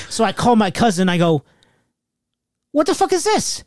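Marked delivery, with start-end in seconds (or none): 2.7-3.6 high-pitched incredulous demand; retold indignant demand. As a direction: demand an explanation in a much higher voice. Retold angry call to cousin.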